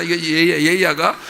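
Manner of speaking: ordinary talk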